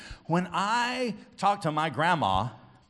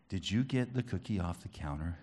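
low, soft imitation of grandma; grandma questioning; 9 semitones lower, 8 dB quieter than usual. Grandma asking grandson about a cookie, quiet questioning. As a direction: ask it quietly, as grandma would. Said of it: "She said".

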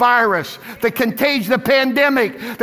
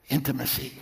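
very soft, low single word; hushed; 12 semitones lower, 12 dB quieter, faster than usual. Retold or imitated. Preacher voicing banker's answer, hushed and tender. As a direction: breathe it out tenderly.

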